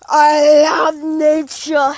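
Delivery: very slow, stretched-out declaration; milk every word, slowly; hammed-up, long-held vowels; drawn-out gushing. Hammed-up gushing over nature.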